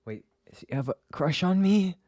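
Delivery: realizing the doctor has a crush, excited high squeal; giddy disbelief. Real, not acted. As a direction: squeal as it dawns on you. Flustered realization.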